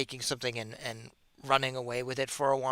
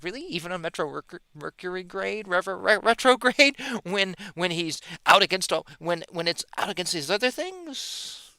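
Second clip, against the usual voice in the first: mock incredulous; 5 semitones higher, 5 dB louder than usual. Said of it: "And they'd be like".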